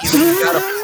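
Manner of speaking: slow, high squawking Iago voice. Shown as a demonstration. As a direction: show them how the parrot says it. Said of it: "you gotta do it".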